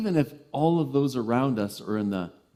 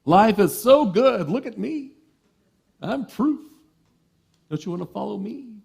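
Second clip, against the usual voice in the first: smug, showy boast pitched higher; self-important zealot; 8 semitones higher, 5 dB louder than usual. Retold or imitated. Overzealous egoic person showing off, a hint of hammed-up smugness. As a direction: preen like someone who wants followers. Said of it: "all about me".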